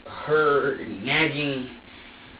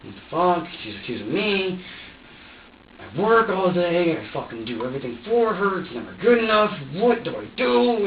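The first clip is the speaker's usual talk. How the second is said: raised, higher voice, venting frustration; exasperated rant; 5 semitones higher, 3 dB louder than usual. Retold or imitated. Worn-out parent venting about an absent partner, exasperated rant.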